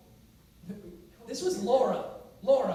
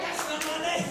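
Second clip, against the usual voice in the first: mock yell; 7 semitones higher than usual. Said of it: "that I wanna yell".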